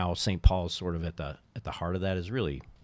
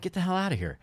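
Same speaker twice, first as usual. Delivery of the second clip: high-pitched, incredulous reaction; retold disbelief; 10 semitones higher, faster than usual. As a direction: act out amazed disbelief.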